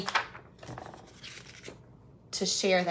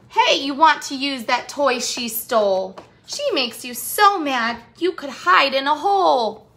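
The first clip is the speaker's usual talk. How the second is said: lively, louder sing-song read-aloud; storybook rhyme reading; 4 semitones higher, 6 dB louder than usual. Acted. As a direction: read the rhyme to the kids with bounce. Rhyming picture book read aloud.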